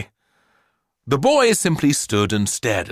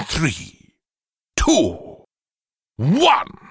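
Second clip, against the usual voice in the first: a mad professor counting down, rising higher; building countdown; 6 semitones higher, much slower than usual. A narrator's reading. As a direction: build up the suspense to launch.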